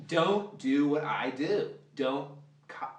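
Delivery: ordinary delivery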